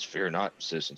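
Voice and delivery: different voices. Acted. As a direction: drop down for the answering voice. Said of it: "saying in different voices".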